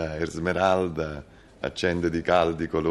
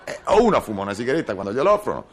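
louder, higher, fast irritated bickering; 9 semitones higher, 3 dB louder than usual. Acted. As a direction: snap back at the wife. Bickering over cigarettes.

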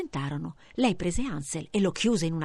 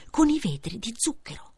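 breathy hush over the sugar windows; hushed storyteller wonder; whispered wonder; 5 semitones higher than usual. A narrator's reading. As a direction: whisper it in awe. Marvelling at candy house.

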